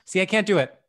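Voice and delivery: ordinary talk, nothing marked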